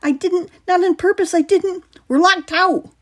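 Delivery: high, loud panicky denial; tripping over the words; frightened boy insisting; stammering. A narrator's reading. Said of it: "Miles stammered".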